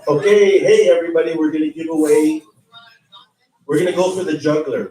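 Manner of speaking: loud, hyped-up emcee calling the crowd; booming prize announcer. Acted. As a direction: boom it out like a raffle host.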